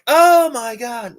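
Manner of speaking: high, loud, drawn-out mock amazement; imitated amazed scream. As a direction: imitate a crowd losing it at a trick. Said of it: "is when people scream so loud".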